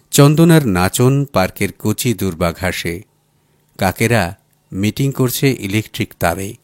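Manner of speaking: ordinary delivery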